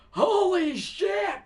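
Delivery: high, slow shocked yell with a laugh; pained shout. Retold or imitated. A heavy guy falling on his leg in the mosh pit, a high slow yell. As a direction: cry out as the leg gets crushed. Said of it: "I'm like".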